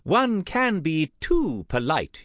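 high, slow, emphatic society lady; theatrical high-pitched reproof; chiding aunt. Acted. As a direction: lecture him with drawn-out emphasis. Aunt Tessa chides her nephew.

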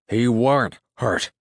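rustic farmer's indignant contradiction; emphatic denial. A narrator's reading. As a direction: shoot the idea down forcefully. Neighbor sharply contradicts.

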